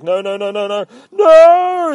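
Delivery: high, loud noes climbing into a scream; hammed-up panic. Retold soldier about to be lifted up, screaming panic. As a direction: escalate from frantic pleading to a wail. Acting the man being grabbed.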